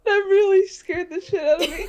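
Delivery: high, breathless laughing words; laughing hard. Real, not acted. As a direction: laugh through it, still shaken.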